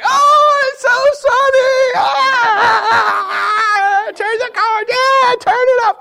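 very high, loud wailing shouts; high shrieking children in the car; kids screaming. Retold or imitated. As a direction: wail like kids blinded by sun. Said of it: "they start screaming".

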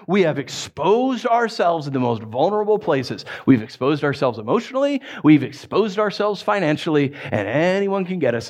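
ordinary talk

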